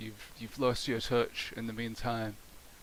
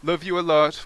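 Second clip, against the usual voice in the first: "Love you a lot" said again, louder, for the listener; louder repeat; 2 semitones higher, 8 dB louder than usual. Pretend. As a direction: repeat it louder over a bad line. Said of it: "Say again, sorry?".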